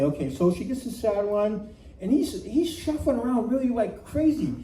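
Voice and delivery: ordinary talk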